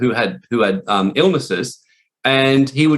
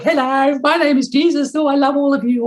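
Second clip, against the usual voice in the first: high-pitched, syrupy mock-holy voice; hammed-up falsetto parody of a wussy Jesus; smiling love-and-light Jesus; 13 semitones higher than usual. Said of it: "love and light wussies who'd walk around smiling".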